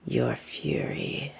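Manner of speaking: slow, menacing whisper close to her ear; sinister hushed whisper. Acted. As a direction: breathe the words right into her ear. Villain whispers to captive.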